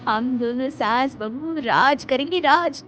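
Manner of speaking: high, loud daydreaming bahu; excited boasting. Acted. Daughter-in-law telling mother-in-law about coming fame, excited.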